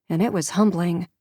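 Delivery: usual speaking voice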